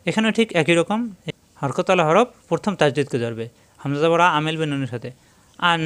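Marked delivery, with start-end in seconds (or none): 3.8-5.8 আন held long through the nose; nasalised n held for the ghunna; nasal ghunna. Shown as a demonstration. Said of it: "নাকের ভিতরে বেশিক্ষণ ধরে রাখে".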